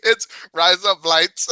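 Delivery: high, slow, cracking up into laughter; laughing. Real, not acted. Host delighted the accent trick works, laughing. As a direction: barely get it out before bursting out laughing.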